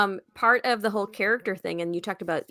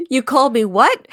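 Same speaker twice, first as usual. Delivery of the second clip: quoting indignant dad; 7 semitones higher, 12 dB louder than usual. Retold or imitated. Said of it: "My favorite part".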